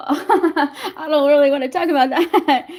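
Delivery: drawn-out, laughing reluctance; embarrassed laugh in the voice. Real, not acted. Laughing at her own uncomfortable realization.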